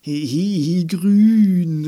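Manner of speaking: loud, high gleeful squeal; giggle, then cry out with delight; giggly cry. A narrator's reading. Andi spots wine-gum asteroids, loud giggly cry. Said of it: "rief sie".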